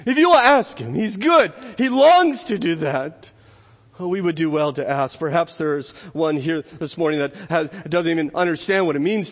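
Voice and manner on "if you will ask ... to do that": raised, forceful assurance; impassioned urging from the pulpit; fervent preaching. Real, not acted. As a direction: press the promise home, high and loud. Preacher urges the congregation to ask.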